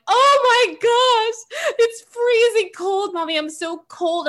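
put-on high, slow, drawn-out whine of a cold child; mock the kids complaining, stretched and pitiful; imitating whiny kids. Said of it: "and they're like".

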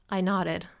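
usual speaking voice

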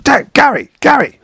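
exasperated shouting. Retold or imitated. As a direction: yell for him, losing patience. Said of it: "then he comes out again".

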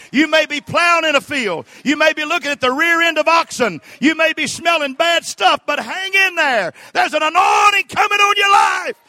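high, shouted exhortation building to a peak; rally the congregation with a loud, high preacher's cry; full-force preaching. Real, not acted. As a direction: build to a held shout, then come down at the end.

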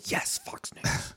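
whispered, slow mock cheer of a Fox fan; mock listener cheer. Imitating half the listeners, whispered slow mock cheer. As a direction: breathe it like a delighted fan. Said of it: "half of our listeners go".

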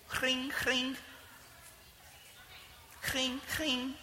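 soft "cring, cring" phone ring; imitated phone ring. Caller waiting on the line, mimicking the phone ringing softly. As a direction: hum the ringtone quietly, like a phone line.

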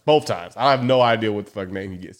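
ordinary talk, nothing marked

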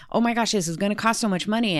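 hammed-up worry about the cost; mock panic. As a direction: rattle it off in rising alarm. Voicing her own spiraling worries.

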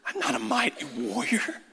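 small, high, softened voice; acted meek, high-pitched protest; timid Gideon. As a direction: shrink back in scared disbelief. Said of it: "Gideon's response is much like".

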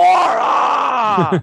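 loud, high war yell; hammed-up charge into battle; mock battle cry. Coach acting out going to war with sword, mock battle cry.

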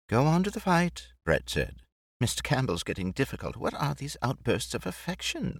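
airy, amused society lady; Brett, light and teasing. Acted. Narrator voices Brett chatting with Jake and Mike, amused. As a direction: tease him lightly about the hat.

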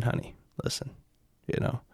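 usual speaking voice

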